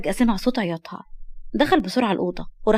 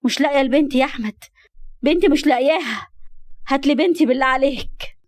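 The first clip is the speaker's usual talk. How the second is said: raised, pleading cry of a distraught mother; frantic mother; 4 semitones higher than usual. Acted. Mother begging her husband to find her missing daughter, frantic. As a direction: beg in mounting panic for your child. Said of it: "كانت واقفة هدى وهي بتلطم".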